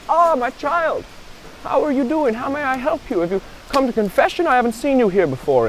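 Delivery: put-on high-pitched kindly old monk voice. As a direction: greet him as a high-voiced old father. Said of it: "this old portly fellow walks out".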